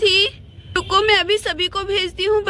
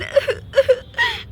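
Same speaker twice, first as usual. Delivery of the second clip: high crying wail; 8 semitones higher than usual. Acted. Bride crying at farewell.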